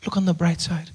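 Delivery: soft, hushed mother's reassurance; gently calm a crying child; imitated mother, very quiet; soothing mom. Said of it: "And she says".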